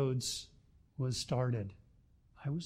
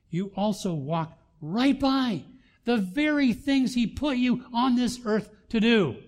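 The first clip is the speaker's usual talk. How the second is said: loud, high, emphatic warning from the pulpit; forceful preaching; 8 semitones higher, 8 dB louder than usual. Real, not acted. Preacher warning the arrogant who leave God out, forceful. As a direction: build to a ringing, high-pitched admonition.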